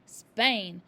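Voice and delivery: loud flat reply; curt father. A narrator's reading. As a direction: snap the one word. Father answers curtly.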